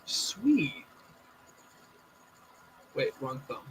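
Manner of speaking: glitchy audio dropping out; breaking up like a bad connection; audio cutting out. Real, not acted. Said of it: "your audio keeps cutting out".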